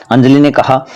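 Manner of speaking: plain reading voice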